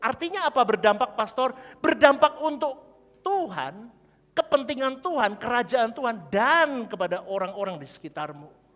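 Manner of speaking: loud high preacher's cry; fiery sermon shout; preaching at full force. Real, not acted. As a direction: thunder it out to the church. Preacher proclaims.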